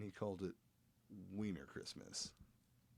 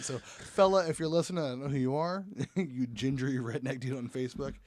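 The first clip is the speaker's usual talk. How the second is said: loud call-out; 4 semitones higher, 9 dB louder than usual. Real, not acted. Calls out to the Facebook guy.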